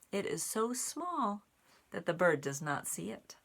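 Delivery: lively storybook narration; reading to children. A narrator's reading. Reading the bird page to children, lively storytime voice. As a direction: make the bird a little drama. Reads picture book aloud.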